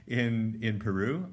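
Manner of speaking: ordinary talk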